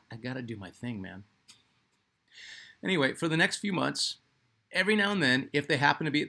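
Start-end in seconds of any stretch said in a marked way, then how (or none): 0.0-1.3 unimpressed. Retold or imitated. Brother asked about ball handling by a star, imitated unimpressed mumble. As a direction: imitate a brother too focused to care. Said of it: "wasn't of, "Oh, cool." It was like".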